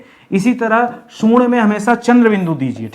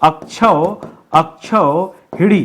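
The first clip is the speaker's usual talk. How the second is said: slow; much slower than usual. Shown as a demonstration. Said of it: "अक्षौहिड़ी होता है".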